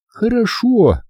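put-on high girl's triumphant хорошо. A narrator's reading. Hilda triumphs over Rick, high. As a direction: crow it as the girl. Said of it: "с торжеством сказала она".

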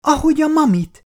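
put-on a little girl bursting with joy; girl crying out about the baby's name, high and loud; excited child cry. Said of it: "kiáltotta a kislány".

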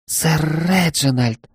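slow, high breathless gasp of surprise; young maid, startled and flustered. Acted. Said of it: "Она ахнула".

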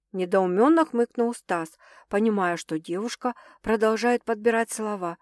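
ordinary delivery, nothing marked

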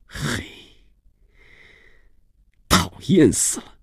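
high-pitched grumble to oneself; exasperated self-talk. Acted. Gaoen walking away from a tiresome guest, exasperated self-talk. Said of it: "边对自己说".

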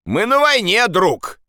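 high-pitched scornful call; shouting. Acted. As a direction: call down a retort. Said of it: "крикнул кто-то". (резкий окрик)

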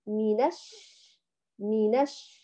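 منش said slowly, n gliding into the doubled sh; joined reading. Shown as a demonstration. Teacher drills the joined sound for the students, slow. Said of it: "فنقول".

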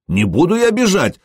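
high-pitched indignant refusal. A narrator's reading. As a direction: refuse with mild offended protest. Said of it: "возмутился Ход". (возмущённо, высоким голосом)

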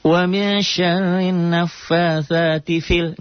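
high slow chant with short fi; recitation demo, short vowel. Shown as a demonstration. Teacher showing the wrong short reading, high chant. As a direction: recite it clipping the long vowel. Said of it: "nggak panjang".

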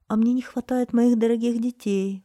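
high, loud heartfelt outburst; exclaiming. A narrator's reading. Mother missing her dear children, exclaiming. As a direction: cry it out as a longing mother. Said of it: "воскликнула мать".

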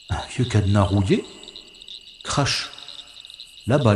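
ordinary delivery

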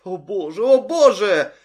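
terrified scream. Acted. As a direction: panic rising to a shriek. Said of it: "продолжала повторять девушка". (в ужасе, срываясь на крик)

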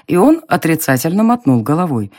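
usual speaking voice

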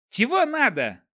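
high rude dwarf voice; pixie. A narrator's reading. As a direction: snap at the guests, high and sharp. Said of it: "довольно нелюбезно осведомился он".

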